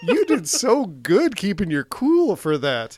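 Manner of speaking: loud, high, slow gushing through laughter; gushing praise. Retold or imitated. Host praising the actor for keeping cool, gushing loudly. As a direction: heap on drawn-out, laughing praise.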